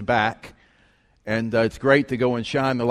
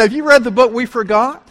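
high, loud retold question; emphatic self-plug; 11 semitones higher, 7 dB louder than usual. Author re-enacts pitching his book to a stranger, emphatic plug. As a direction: pitch it up and plug it proudly for laughs.

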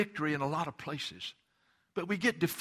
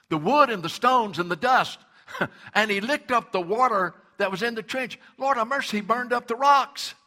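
much louder, higher, driving sermon cadence; full-force preaching; 8 semitones higher, 11 dB louder than usual. Acted. Preacher building to a shout that nothing is too hard for God. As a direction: preach it to the rafters, hallelujah. Preaching climax, fire fell.

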